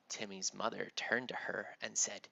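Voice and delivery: ordinary delivery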